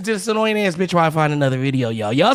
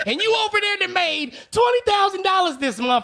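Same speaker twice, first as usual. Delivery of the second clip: shouting; 8 semitones higher than usual. Real, not acted. Ranting at caller's cheap headset.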